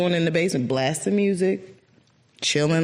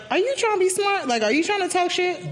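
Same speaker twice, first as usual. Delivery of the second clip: high, prickly "are you trying to be smart"; defensive challenge; 13 semitones higher than usual. Retold or imitated. Feeling the brother's calm words, high offended challenge. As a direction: push back, offended. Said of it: "almost like".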